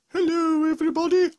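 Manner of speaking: high loud cartoon greeting; Gruffalo voice. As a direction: boom out a silly monster hello. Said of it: "Third up we have the Gruffalo".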